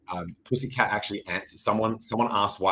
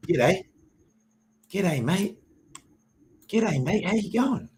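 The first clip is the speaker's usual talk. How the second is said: rising ever higher, chirpy Australian g'day mate; high Aussie greeting; 10 semitones higher, slower than usual. Pretend. Greeting the viewers in a squeaky Australian voice. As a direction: sing out an Australian hello, climbing up.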